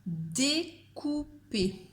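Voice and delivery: découper drawn out slowly; slow dictation. Shown as a demonstration. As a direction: say découper slowly for pupils to write. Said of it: "Je répète une dernière fois".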